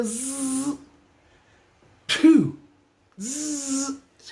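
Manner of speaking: high hissing spit noise with a pew; imitated spitting. Comic telling the crowd about the spit, high hissing noise.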